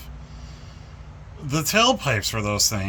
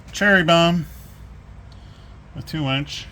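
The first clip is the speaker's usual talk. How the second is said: ordinary talk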